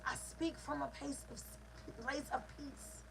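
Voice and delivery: soft, hushed peace; peaceful. Shown as a demonstration. Coach showing speech from a place of peace, peaceful. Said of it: "If my heart requires peace".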